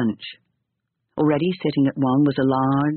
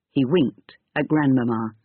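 ordinary delivery, nothing marked

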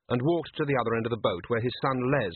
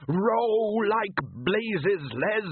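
higher, slow desperate shout; urgent shout; 10 semitones higher, much slower than usual. Acted. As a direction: urge the boy to row for his life. Father panicking at rising thing.

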